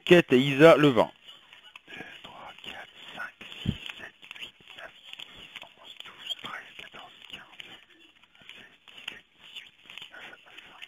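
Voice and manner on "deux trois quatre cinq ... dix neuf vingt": counting the cards in a whisper; murmured count while dealing cards. Real, not acted. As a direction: whisper the numbers under your breath. Counting cards under breath.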